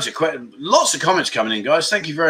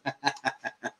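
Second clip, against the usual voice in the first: quiet laugh; 4 semitones lower, 13 dB quieter than usual. Real, not acted. Laughing at a viewer comment.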